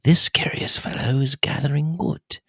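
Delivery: hushed nature narrator, lightly voiced; Attenborough impression. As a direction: murmur it like a wildlife documentary. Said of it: "the lower voice where he's whispering".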